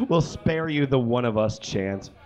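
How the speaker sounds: ordinary delivery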